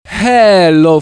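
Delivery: ordinary talk, nothing marked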